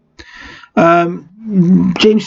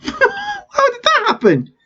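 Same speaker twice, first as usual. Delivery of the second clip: laughing; 3 dB quieter, slower than usual. Real, not acted. Laughs at game error.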